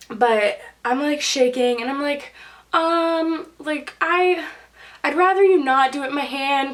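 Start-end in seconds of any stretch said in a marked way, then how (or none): 2.7-6.7 higher-pitched, halting plea; mildly anxious, hesitant retold request; retold nervousness. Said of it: "That makes me really nervous".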